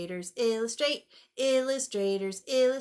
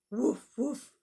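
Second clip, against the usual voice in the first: dog bark. Acted. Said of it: "Can you sound like Biscuit".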